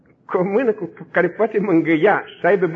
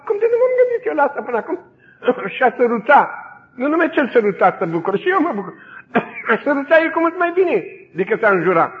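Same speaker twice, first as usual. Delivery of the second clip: amazed excitement; 8 semitones higher, 4 dB louder than usual. Retold or imitated. The interrogator is amazed by the idea.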